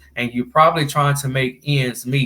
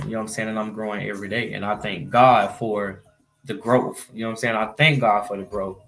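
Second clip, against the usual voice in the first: ordinary talk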